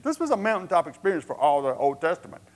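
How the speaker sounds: ordinary delivery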